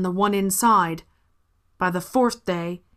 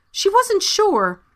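usual speaking voice

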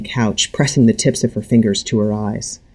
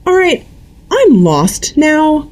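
dangerous buzz; 4 dB louder, slower than usual. A narrator's reading. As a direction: let the anger buzz under the words. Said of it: "her voice taking on a dangerous buzz".

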